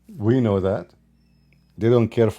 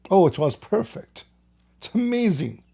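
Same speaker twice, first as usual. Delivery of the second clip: higher-pitched fawning imitation; mock praise; 6 semitones higher than usual. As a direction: gush in sham admiration. Mimics fawning praise of the rulers.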